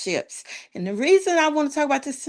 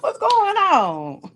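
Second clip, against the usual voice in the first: excited greeting; 10 semitones higher than usual. Real, not acted. Upbeat greeting to co-host.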